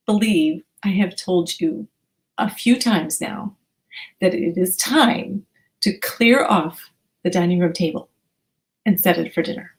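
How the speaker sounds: a hint of a displeased mom spelling it out; each syllable clipped out to a child who won't clear the table; over-enunciated. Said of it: "our version of yelling is you over enunciate".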